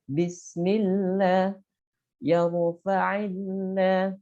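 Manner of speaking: slow examples, each lam thin and light. Shown as a demonstration. Teacher lists kasrah examples, slowly. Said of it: "maka dibacanya adalah tarkik".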